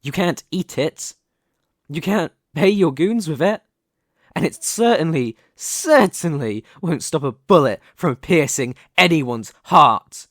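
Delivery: loud, emphatic, building to a hard last word; impassioned warning. Acted. Warning an armed rival that pride stops no bullet, impassioned. Climax of an armed standoff speech.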